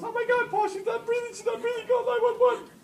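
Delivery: high, loud imitation of a panicked wife; mock hysterics at full pitch; freaking out. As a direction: shriek it as a hammed-up panicking mother. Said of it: "my wife freaks out and goes".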